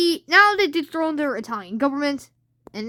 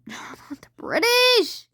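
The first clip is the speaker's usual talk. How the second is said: laughing whisper; 9 semitones higher, much slower than usual. Real, not acted. Laughing self-correction mid-sentence.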